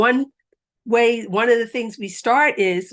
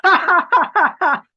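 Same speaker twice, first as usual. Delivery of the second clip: loud, high laughing into a pretend phone; phone laughter; 9 semitones higher, 6 dB louder than usual. Shown as a demonstration. Pretending a girlfriend tells a funny story, loud high laughter. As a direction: laugh as if a friend cracks you up. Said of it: "we're gonna go".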